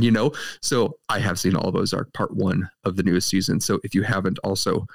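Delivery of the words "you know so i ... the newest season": ordinary talk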